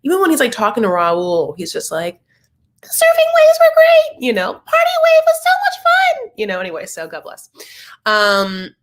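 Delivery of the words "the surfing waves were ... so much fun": very high, loud whiny voice; mock a high whiny surfer boy; imitating whiny squeaky Corey. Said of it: "his voice is whiny".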